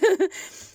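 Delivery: sudden squeaky laugh; high burst of laughter. Real, not acted. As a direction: let out a quick high laugh.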